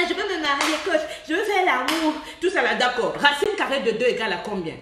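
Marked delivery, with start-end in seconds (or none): 0.1-2.1 whiny imitation of a follower; mock the naive woman pleading; hint of a pleading woman's voice. Mocking a follower's plea.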